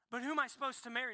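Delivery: rushed, pestering question; anxious fast question. Acted. Believer pestering God about a spouse, rushed and anxious. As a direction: blurt it out fast like an impatient asker.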